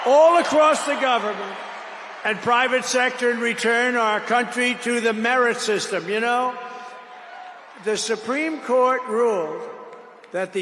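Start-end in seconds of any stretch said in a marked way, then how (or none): 0.1-6.1 loud rally speech; politician. Real, not acted. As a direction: punch it like a campaign rally. Rally speech at full force.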